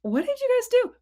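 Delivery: high, teasing questioning voice; a lightly voiced questioning God; mock God asking. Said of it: "God's like".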